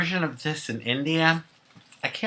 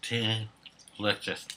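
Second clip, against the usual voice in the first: savoring delicious food; 2 semitones lower, 8 dB quieter than usual. Real, not acted. Eating and savoring crab.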